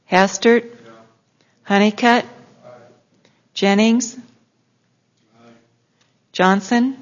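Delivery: normal talking voice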